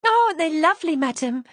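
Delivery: thrilled maid gushing over a bouquet; delighted. Acted. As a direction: maid delighted by roses, gushing to her mistress. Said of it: "she said with delight".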